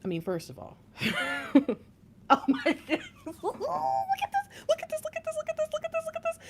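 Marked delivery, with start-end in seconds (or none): 0.9-3.1 high, laughing exclamation; astonished laughter. Real, not acted. 3.4-6.4 very high squeal, repeated over and over; freaking out. Real, not acted.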